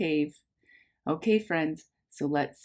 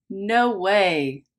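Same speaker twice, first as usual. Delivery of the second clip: ordinary talk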